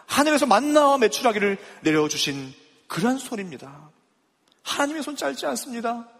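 rising sermon fervour, pitched high; fervent preaching. Acted. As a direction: drive each question home to the pews. Preaching at full force.

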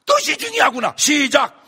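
fiery preaching. Acted. Preacher shouts the lesson to the congregation, fiery. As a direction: drive the point home at full force.

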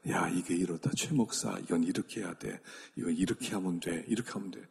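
low, quiet inner prompting; quietly instruct, pitched well down; Holy Spirit's inner voice. Retold or imitated. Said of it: "성령이 내 속에서 계속 말씀하세요".

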